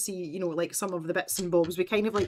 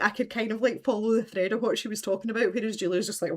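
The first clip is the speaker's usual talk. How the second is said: ordinary talk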